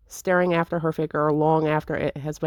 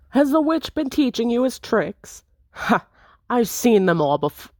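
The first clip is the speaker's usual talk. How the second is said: high, cocky taunting rogue; 6 semitones higher than usual. Acted. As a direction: taunt them with a grin. Intruder taunts mid-fight.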